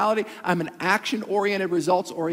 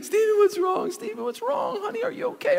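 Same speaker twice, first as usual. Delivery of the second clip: worried mom; 14 semitones higher than usual. Retold or imitated. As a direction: ask like a mother worried about her child. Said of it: "My mom comes to me".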